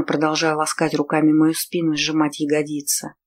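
ordinary delivery

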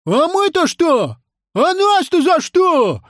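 very high, drawn-out protest; high-pitched pupil's outraged wail; indignant cry. Acted. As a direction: yell that it is unfair. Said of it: "закричал Емеля".

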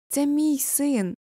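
high, slow call, moderate volume; drawn-out cry. A narrator's reading. Father in the stands claims his son, crying out. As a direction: stretch it out high, a narrated shout. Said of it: "кричить батько".

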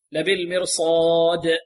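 slow لبالمرصاد, long alif and a bouncing final د; clear qalqalah. Shown as a demonstration. Teacher models the stop, لبالمرصاد ending in an echoed د. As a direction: release the last د of لبالمرصاد with a short echo. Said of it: "والدال هنا مقلقلة".

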